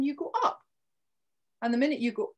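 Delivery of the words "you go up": inviting upward lilt on "up"; questioning rise. Shown as a demonstration. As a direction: pitch lifts high on UP. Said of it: "question or an invitation".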